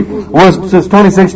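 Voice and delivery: ordinary delivery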